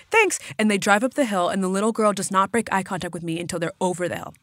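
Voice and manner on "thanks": much higher, tight and polite; squeaky nervous. Retold or imitated. Saying goodbye to the strange couple, nervous and high. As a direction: squeak out a quick thanks. Eager to leave.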